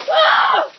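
high shrieking whoop of cold shock; in ice cold water. Real, not acted. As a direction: whoop as the freezing water lands. Ice water just poured over.

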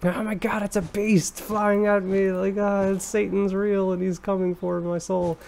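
high, frantic mock terror; hammed-up terrified inner voice; panicked thought. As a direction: play the panic of seeing a demon cat. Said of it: "the first thing you think is".

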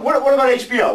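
alarmed. Acted. Partner panicking about press.

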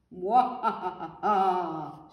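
evil pea's gloating cackle; hammed-up villain laugh, loud at the start; villain's shrieking laugh. A narrator's reading. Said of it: "shrieked the evil pea".

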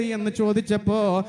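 usual speaking voice

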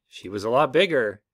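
usual speaking voice

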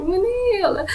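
high, mock-tearful whine; near tears. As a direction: act out almost crying over an injury. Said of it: "my tears were almost on the verge of falling".

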